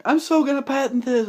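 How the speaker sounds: excited inventor. Retold or imitated. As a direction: gush like a kid with a new gadget. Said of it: "and he's like".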